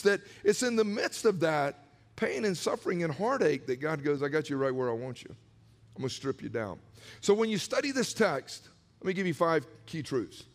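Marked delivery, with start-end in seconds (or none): 4.2-6.8 quiet, low God voice, much softer; a hushed, looming God; low voiced God. Preacher voicing God, low and hushed.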